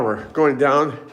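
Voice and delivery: usual speaking voice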